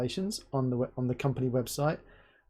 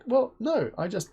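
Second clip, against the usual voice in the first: ordinary talk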